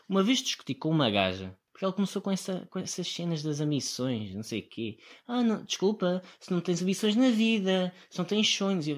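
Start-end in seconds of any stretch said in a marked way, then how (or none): 5.3-8.9 mock preachy girl. Quoting the girl in argument.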